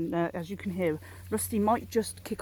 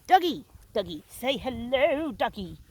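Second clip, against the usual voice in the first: raised, drawn-out call to a pet; coaxing the dog; 4 semitones higher, slower than usual. Real, not acted. Owner coaxing pug Dougie to say hello, singsong coaxing. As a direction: call him, then coo a slow hello.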